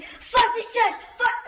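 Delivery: very high, loud explosive curse; sudden furious shout. Retold or imitated. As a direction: blow up out of nowhere. Paul erupts again in church.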